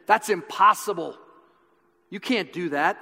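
a touch of scoffing. Retold or imitated. Nobleman casting doubt, a touch of scoffing. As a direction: plant doubt, half-hearted sneer. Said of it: "He tried to put doubt in him".

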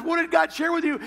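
high, bright excited asking; eager question. Retold or imitated. Cindy eagerly asking her husband what God said. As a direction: lean in, dying to know.

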